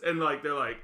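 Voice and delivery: ordinary talk, nothing marked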